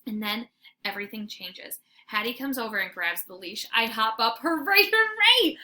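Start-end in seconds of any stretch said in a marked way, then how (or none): 4.4-5.6 high, loud joyful cheer; rising whoops of joy; dog cheering a car trip; excited barking. Acted. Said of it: "I bark".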